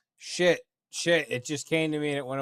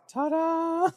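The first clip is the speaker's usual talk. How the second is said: high, bright showman's "Ta-da!", much higher; mock ta-da; 13 semitones higher than usual. Mocking how she'd just block him, high ta-da. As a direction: sing out Ta-da like a magician finishing a trick. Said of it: "and then it's like".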